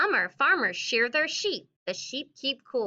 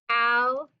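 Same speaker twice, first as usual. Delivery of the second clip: slow dictation; slower than usual. Shown as a demonstration. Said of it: "I'm gonna put that on my chart".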